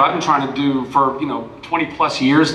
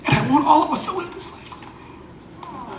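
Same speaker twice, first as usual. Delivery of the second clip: tearful; 10 semitones higher, 7 dB quieter than usual. Real, not acted. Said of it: "for making me weep".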